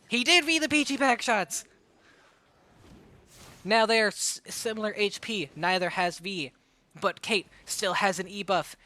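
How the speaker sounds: fast, hyped esports casting; play-by-play. Acted. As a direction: call the match live.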